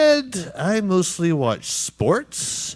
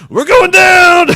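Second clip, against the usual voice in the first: screaming high as the plane falls; panicked shout; 14 dB louder than usual. Acted. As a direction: yell it in full alarm. Pilot panics as plane crashes.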